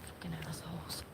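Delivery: whispered swear under the breath; bitter whispered cursing; muttered curse. Real, not acted. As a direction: hiss it to yourself, barely voiced. Muttering alone after cops leave.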